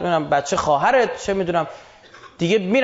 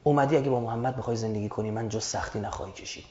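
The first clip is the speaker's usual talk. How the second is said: low, hushed acted send-off; quiet, discreet dismissal; 10 semitones lower, 9 dB quieter than usual. Husband quietly sending his wife to her father's house, hushed. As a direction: keep it down, as if nobody should hear. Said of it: "طلاق نیکو و بی سر و صدا".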